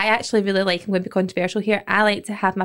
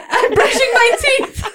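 higher, slower, laughing silly voice; mock high voice; 12 semitones higher, 3 dB louder, slower than usual. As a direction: act out a friend in a squeaky voice. Acting out friend's bathroom scene.

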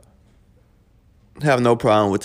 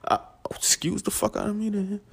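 whispered mock offense; offended whisper; 9 semitones higher, 7 dB quieter than usual. Real, not acted. Daughter's retort leaves him stung.

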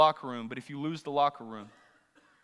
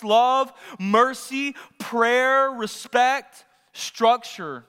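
higher, driven preacher's voice; full-force preaching; 8 semitones higher, 8 dB louder than usual. Acted. Preacher asking the congregation what homes are built on, full-force preaching. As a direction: preach it hard, hammering the key words. Preaches with rising insistent questions.

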